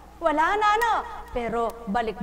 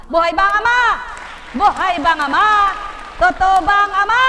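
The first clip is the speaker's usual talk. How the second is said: loud, higher call and response; preacher shout; 6 semitones higher, 9 dB louder, much faster than usual. Real, not acted. Preacher to congregation, loud call and response. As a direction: shout the question to the crowd.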